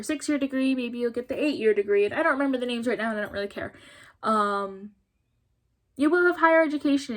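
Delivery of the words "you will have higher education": emphatic yelling. Real, not acted. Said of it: "Sorry for yelling at you back there".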